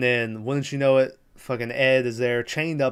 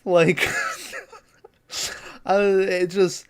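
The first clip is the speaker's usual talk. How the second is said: laughing; 6 semitones higher, slower than usual. Real, not acted. Laughing at the movie's logic.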